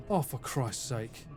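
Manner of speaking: usual speaking voice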